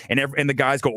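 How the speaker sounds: ordinary delivery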